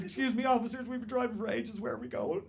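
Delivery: high, meek imitation of a passenger; timid stag. Stag pipes up from the back seat, timid and high. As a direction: put on a small, polite, worried voice. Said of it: "Will perks up and he says".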